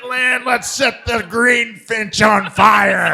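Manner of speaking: slow, loud, high shout; rally the mob, drawn out; mock rally cry. Guest rallying the crowd to burn the bar, loud slow shout.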